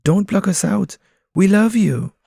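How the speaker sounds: high, loud pleading pigeon voice; protesting pigeons. Acted. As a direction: beg him to let them in. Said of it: "the pigeons protest".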